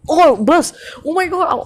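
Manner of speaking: high, sudden gamer exclamation; excited outburst. Real, not acted. Player spotting loot mid-match, excited outburst. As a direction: burst out at a lucky find.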